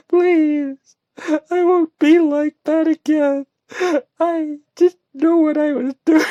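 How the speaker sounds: high, loud tearful pleading; crying, begging. A narrator's reading. Woman begging her friend not to throw her out, crying. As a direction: beg him through sobs not to be thrown out. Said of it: "Joanna began to cry again".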